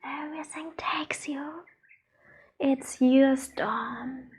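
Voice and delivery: ordinary talk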